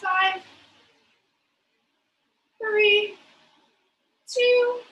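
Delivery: counting down loud and high; loud countdown. Real, not acted. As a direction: call out the count to push them. Coach counting down the last reps.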